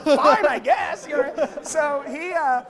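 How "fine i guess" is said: slow, laughing, unsure fine I guess; a laughing, puzzled fine; bemused reply. Retold or imitated. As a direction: shrug it off, baffled. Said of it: "I was like".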